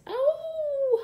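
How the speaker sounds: very high yelp of pain; pained howl. A narrator's reading. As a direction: cry out as the bee stings. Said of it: "howled Little Wolf and Smells".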